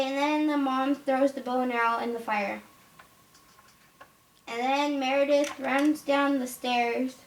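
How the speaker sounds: usual speaking voice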